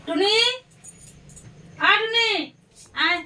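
high, loud summons; calling out. Real, not acted. Calling Tuni through a shut door, calling out. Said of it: "আর টুনিকে ডাক দেই".

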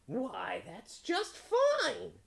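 very high, slow, loud bird; delighted crow. A narrator's reading. Crow relieved by the promise, drawn-out high crow voice. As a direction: squeal it out, stretching the words.

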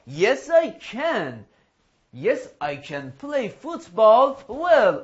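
very slow, drawn-out model answer; slow drill answer. Shown as a demonstration. Teacher models the answer to a football question, slow drill. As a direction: spell out the answer word by word.

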